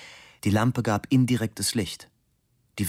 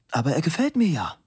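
much higher and faster reply; quick lightly voiced husband; 8 semitones higher, faster than usual. Husband breezily reassuring his wife, fast and high. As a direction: brush it off quickly.

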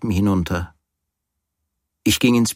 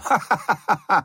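high, open-mouthed hearty laugh; 11 semitones higher than usual. A narrator's reading. The landlord laughs heartily at his lodger's remark. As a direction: laugh warmly as at a great joke. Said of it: "Er lachte herzlich".

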